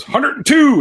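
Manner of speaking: high, loud inmate's call; yelled out. Acted. Another inmate pops up with a joke number, high acted yell. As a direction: sing out the number across the cafeteria. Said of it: "someone will yell out a number".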